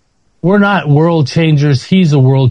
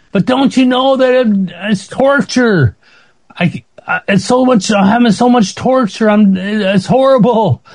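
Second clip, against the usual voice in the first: anguished pleading; 9 semitones higher than usual. Retold or imitated. Pleading with God about torture.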